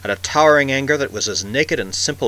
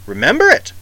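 high, loud disbelieving echo; incredulous; 11 semitones higher, 7 dB louder than usual. A narrator's reading. Campbell scoffs at the old man's question, incredulous.

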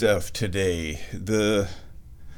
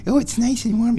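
high, louder coo on oh; 12 semitones higher, 4 dB louder than usual. Real, not acted. Baby talk to the cat.

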